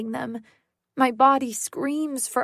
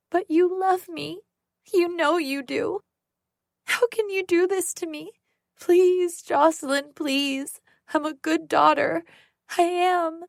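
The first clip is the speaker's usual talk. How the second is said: put-on high, tearful little-girl pleading; sobbing plea; 7 semitones higher than usual. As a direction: cry like a hurt child begging. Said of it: "Sarah starts to sob".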